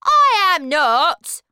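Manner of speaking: high, loud, slow yell; shouted. Acted. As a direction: shout it down stubbornly. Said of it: "he shouted".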